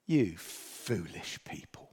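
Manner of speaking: low, slow, mildly stern Jesus; a lightly voiced teacher's rebuke, slow; gentle rebuke. Shown as a demonstration. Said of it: "I want to do that in the voice of".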